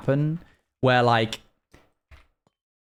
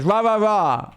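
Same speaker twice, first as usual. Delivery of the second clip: higher-pitched sing-song mimicry; imitated dog's owner; 6 semitones higher than usual. Streamer retelling her teaching the dog, higher mimicking voice. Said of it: "And she goes".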